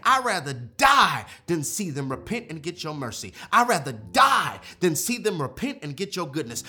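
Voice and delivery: impassioned preaching tirade, leaning hard on key words; angry Jonah. Acted. Preacher voices Jonah railing at God, angry and impassioned. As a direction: protest to God with mounting force. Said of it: "Jonah was saying".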